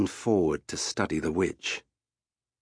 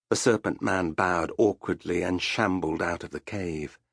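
ordinary delivery

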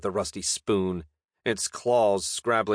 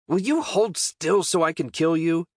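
higher-pitched, frustrated demand; exasperated; 8 semitones higher, 3 dB louder than usual. Acted. Knight scolds the dodging goblin, exasperated and high. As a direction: plead crossly with a target that keeps dodging.

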